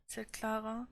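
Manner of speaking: ordinary delivery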